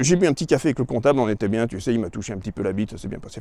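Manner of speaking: fast, dropping to a murmur; fading to quiet. Acted. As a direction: let it sink away quietly. Calmed down, fading.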